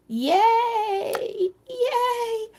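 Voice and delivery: very high, drawn-out squeal; excited cheer. Real, not acted. Host celebrates players' right guesses, excited squealed cheer.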